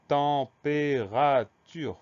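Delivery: "température" drawn out slowly, each syllable held apart; teacher's slow dictation of "température", syllable by syllable. Shown as a demonstration. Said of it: "Première syllabe".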